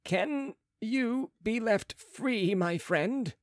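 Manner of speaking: slow, spaced-out question; careful questioning. A narrator's reading. Surgeon questions the mesmerised patient, slow and careful. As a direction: pick the words out one by one.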